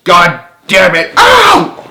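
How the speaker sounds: loud furious shout breaking into a high yelp; explode, then yelp in pain; shouting in rage then pain. A narrator's reading. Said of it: "Frank lost it".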